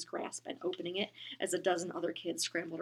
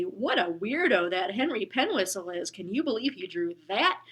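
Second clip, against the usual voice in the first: mild mock jeer; 8 dB louder than usual. Imagined kids mocking.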